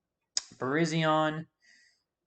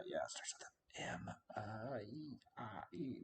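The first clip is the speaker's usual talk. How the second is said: whisper; 14 dB quieter than usual. Real, not acted. Trying to recall a Pokemon name.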